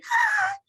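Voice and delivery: sharp gasp. Retold or imitated. Retelling her labor scream.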